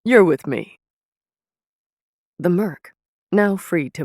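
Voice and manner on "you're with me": commanding order. Acted. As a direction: lay down the order like a sorcerer who expects obedience. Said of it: "bastard-looking mercenary and said".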